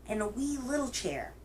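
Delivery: usual speaking voice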